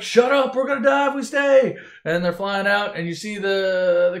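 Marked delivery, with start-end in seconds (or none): 0.0-1.8 high, loud mock-angry shout; Han Solo shouting back. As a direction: yell down the panicking crew. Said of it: "Han's like".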